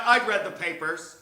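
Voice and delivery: plain reading voice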